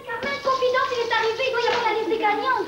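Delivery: high, eager call; excited announcing. Acted. Girl brings Carmen the winners' list, excited high call. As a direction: call out the big news. Bursting in with contest news.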